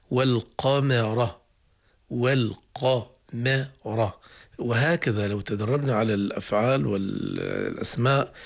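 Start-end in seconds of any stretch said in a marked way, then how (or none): 0.1-4.1 والقمر drawn out very slowly, with a light م between the heavy ق and ر; والقمر with the م kept light; light letter between heavy ones. Shown as a demonstration. Teacher drawing out والقمر. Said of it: "نحتاج في مثل".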